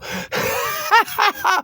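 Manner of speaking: a sudden burst of high laughter; loud laugh. Retold or imitated. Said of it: "I just start laughing out loud".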